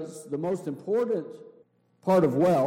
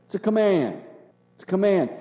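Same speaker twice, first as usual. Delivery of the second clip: louder, hammering "It's a command"; emphatic preaching; 5 semitones higher, 7 dB louder, faster than usual. Acted. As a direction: drive the command home like a preacher. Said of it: "preacher driving the point home".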